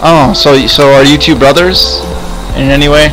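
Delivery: ordinary talk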